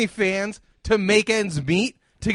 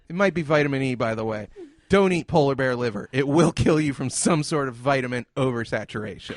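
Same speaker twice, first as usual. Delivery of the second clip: much lower, matter-of-fact mutter; low deadpan aside; 11 semitones lower than usual. Real, not acted. Telling Michael about polar bear liver, low and deadpan. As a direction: drop your voice low and state it flatly.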